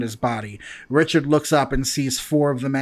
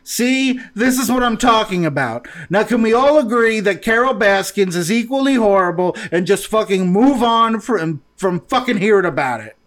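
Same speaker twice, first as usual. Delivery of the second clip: higher, louder fed-up outburst; exasperated rant; 6 semitones higher, 3 dB louder than usual. Acted. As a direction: vent at the others, fed up. Mo rants, fed up.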